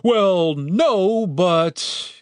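hesitant, high-pitched concession; faltering reluctant admission; giving up. A narrator's reading. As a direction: start to object, then give up. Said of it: "he gave up".

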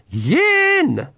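sharp, high pixie shout; high ritual cry. Acted. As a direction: cry the word out like a spell. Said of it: "shouted the Knack McFeagle".

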